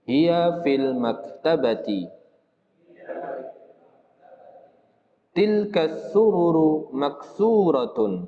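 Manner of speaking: slow, careful Arabic reading for students; slow drill reading. Shown as a demonstration. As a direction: read each sentence slowly and clearly for the class. Reads Arabic drill sentences slowly. (membaca kalimat latihan pelan-pelan)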